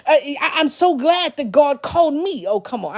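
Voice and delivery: ordinary talk, nothing marked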